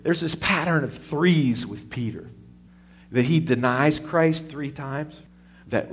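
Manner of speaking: ordinary talk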